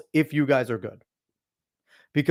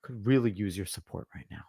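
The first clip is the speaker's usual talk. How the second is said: lower, softer mock of a needy husband; mock needy guy; 7 semitones lower, 9 dB quieter than usual. A man mocking the beta husband asking his wife for support. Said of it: "does not walk up to their wife, and say".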